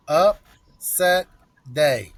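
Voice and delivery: "upset" and "day" each hit hard and loud, split by a pause; drawn-out emphatic "upset day"; punched phrase. Real, not acted. Emphatic prediction.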